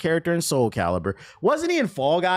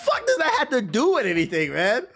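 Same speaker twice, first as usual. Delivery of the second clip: laughing disbelief; 12 semitones higher, slower than usual. Real, not acted.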